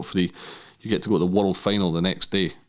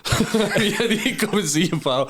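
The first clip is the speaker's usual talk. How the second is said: laughing mock plea; 11 semitones higher, slower than usual. Retold visit to friend Swanee.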